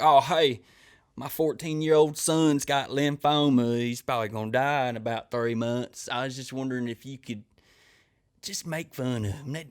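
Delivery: casual, oblivious fan voice with stretched words; cameo requester. Retold or imitated. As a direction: play a clueless parent asking a favor. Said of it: "the crazy requests I get".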